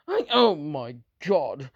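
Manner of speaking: ordinary talk, nothing marked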